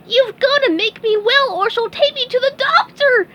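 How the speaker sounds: very high, loud desperate sponge; squeaky yell. Acted. SpongeBob begs Patrick to cure him, high panicked yell. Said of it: "yelled SpongeBob".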